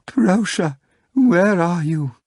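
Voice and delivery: high, slow tearful calling; tender, melodic call. A narrator's reading. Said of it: "in a tender, melodic voice, a little nasal from all the crying".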